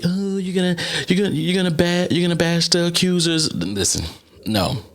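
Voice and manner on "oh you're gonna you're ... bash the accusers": high, whiny imitation of critics; mocking high voice. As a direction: mimic the naysayers in a squeaky tone. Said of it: "I know everybody's wanna say".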